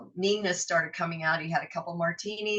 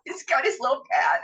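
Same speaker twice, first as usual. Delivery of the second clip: ordinary talk, nothing marked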